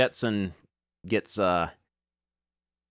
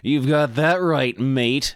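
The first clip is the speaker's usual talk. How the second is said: put-on Aussie drawl for Elias; lightly hammed Australian accent; Australian adventurer voice; 3 semitones higher, 3 dB louder, slower than usual. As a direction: play the greasy Aussie digger, mate. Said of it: "I can't keep doing that voice".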